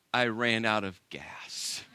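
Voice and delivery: sheepish confession. Retold or imitated. Wife confessing to husband after long pause, sheepish. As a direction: own up reluctantly, drawn out and small.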